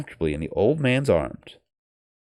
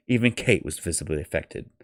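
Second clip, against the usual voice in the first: plain reading voice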